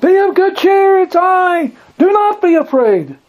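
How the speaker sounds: high-pitched holler over the noise of waves; sustained far-carrying shout. Acted. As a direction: holler it out to carry across the sea. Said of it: "Jesus had to holler at them".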